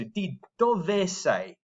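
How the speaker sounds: "Di dove sei?" very slow, the final E in "dove" sounded; slow Italian with clear E. Shown as a demonstration. Teacher modelling for the class, very slow. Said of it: "pronouncing the E there".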